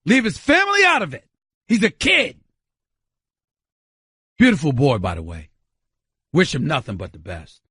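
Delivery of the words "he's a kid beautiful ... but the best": tender aside. Real, not acted. Softening about the kid.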